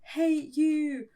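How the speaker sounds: drawn-out, high-pitched mock yell; mock scream. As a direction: stretch it out like a play-acted tantrum. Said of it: "We're screaming".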